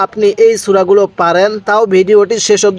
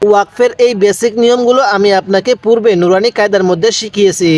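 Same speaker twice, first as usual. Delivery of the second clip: ordinary delivery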